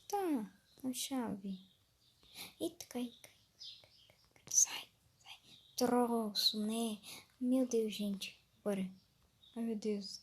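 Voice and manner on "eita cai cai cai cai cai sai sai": urgent whisper. Real, not acted. Urging game item while hiding.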